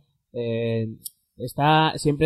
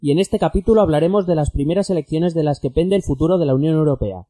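louder radio-host delivery; podcast intro; 3 semitones higher, 4 dB louder than usual. Acted. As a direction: announce the episode topic with punch. (presentación radiofónica enfática)

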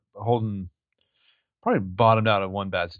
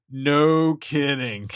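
very slow, drawn-out imitation of deadpan; dry deadpan; 3 semitones higher, much slower than usual. Holden hearing the fiancé is Ezra, imitated dry deadpan. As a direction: drag both words out dryly. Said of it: "he's so dry".

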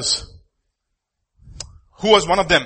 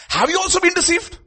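scornful question; 13 semitones higher than usual. Acted. Pharisees scold the officers.

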